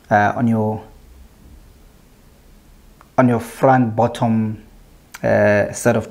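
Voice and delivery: speaking with finger on lip; lip held down by finger. Real, not acted. Seen 1: Finger at lower lip throughout, pulling it down to show bottom teeth.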